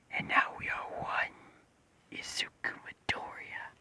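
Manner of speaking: whispered creature voice, drawn out and hushed; slow, eerie whisper from inside someone's head; strange voice in his head. Acted. Creature whispers to Izuku inside his head, strange whispered voice. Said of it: "he hears a strange voice".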